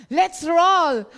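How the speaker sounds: loud rallying cry; rallying shout. Acted. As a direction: belt it out to rouse the congregation. Preacher's rallying catchphrase.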